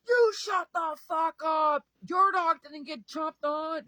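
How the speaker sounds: slow, very loud, high screaming; furious retort. Retold or imitated. Firing back at the neighbor, slow high furious scream. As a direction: hurl every word back at him. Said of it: "And I'm like".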